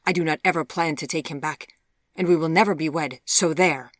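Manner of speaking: loud, emphatic defiance; defiant. A narrator's reading. Daughter vowing never to wed her old beau, defiant. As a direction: finish the argument with a defiant jab.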